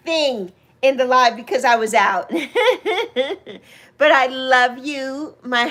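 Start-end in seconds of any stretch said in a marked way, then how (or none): 2.3-3.6 high giggling laugh. Real, not acted. Explaining to friend why she didn't sing, high laugh. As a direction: laugh it off in a quick high run.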